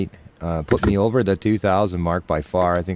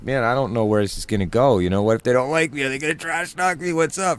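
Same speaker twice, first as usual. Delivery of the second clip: nervous inner worry; 5 semitones higher than usual. Retold or imitated. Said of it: "I was just scared".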